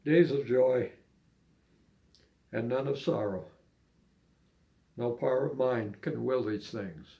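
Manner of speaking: unhurried spoken verse; poem recitation. Acted. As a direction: recite it gently, line by line.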